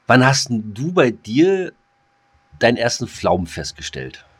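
ordinary talk, nothing marked

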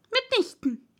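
high, irritated mouse retort; high-pitched indignant denial; decided, very annoyed. A narrator's reading. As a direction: refuse crossly in a high mouse voice. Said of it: "entschieden und sehr ärgerlich".

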